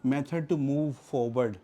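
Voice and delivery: very slow, each word of "method to move forward" set apart; slow summing up. Shown as a demonstration. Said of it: "तो procedure यही तो होता है".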